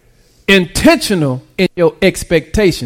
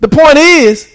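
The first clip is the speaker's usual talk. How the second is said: full-force preaching; 7 semitones higher than usual. Acted. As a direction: preach it hard, lift the whole church.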